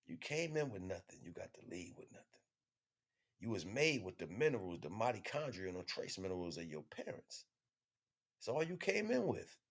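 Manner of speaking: usual speaking voice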